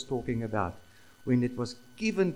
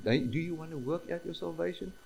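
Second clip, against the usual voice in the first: softer, lightly offered question; mock invitation; 4 dB quieter than usual. Shown as a demonstration. Preacher showing what the command is not, mock invitation. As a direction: ask it as an optional invitation, no force. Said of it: "It's not an invitation".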